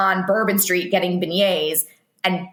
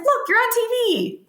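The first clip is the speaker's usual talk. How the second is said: excited call. Retold or imitated. Telling friend he's on TV.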